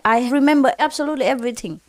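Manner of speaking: rushed, fast insistent repeat. Retold or imitated. Husband insists to his wife, fast and pressing. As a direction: hurry the claim out. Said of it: "He talked to his wife".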